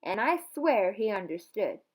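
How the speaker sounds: lively read-aloud, voicing Opal and the preacher; story narration. A narrator's reading. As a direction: read it like a storybook to your class. Teacher reads chapter aloud.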